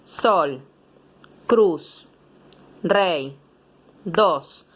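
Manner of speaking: sol, cruz, rey, dos, each said as one clipped beat. Shown as a demonstration. Teacher to pupils, showing single beats, one beat each. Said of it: "se pronuncian en un golpe de voz".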